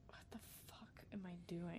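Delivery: hushed, voiceless whisper to yourself; whispered despair. Retold or imitated. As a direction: whisper it under your breath in disbelief. Despairing inner question while pushing amp.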